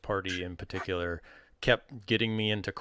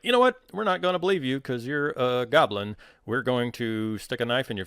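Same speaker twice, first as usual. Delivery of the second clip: higher, louder player confrontation; mock defiance; 5 semitones higher, 5 dB louder than usual. Players refusing to believe a goblin, in mock defiance. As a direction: call the goblin out, playfully indignant. Acts out party challenging the goblin.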